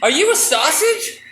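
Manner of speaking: a little mouse peering up in surprise; squeaky mouse. Acted. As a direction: mouse asks with wide-eyed disbelief. Mouse voice again.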